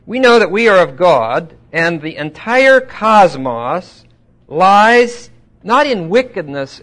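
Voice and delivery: loud, slow emphatic verse; forceful Bible reading; scripture reading. Acted. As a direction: declaim it deliberately, stretching the key words. Reads scripture with heavy emphasis.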